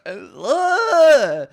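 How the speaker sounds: loud high pained groan; cringing groan. Real, not acted. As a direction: cry out in embarrassment.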